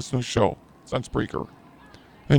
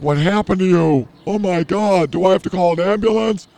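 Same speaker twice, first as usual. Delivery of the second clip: panicked brother; 6 semitones higher, 5 dB louder than usual. Retold or imitated. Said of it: "my brother's like".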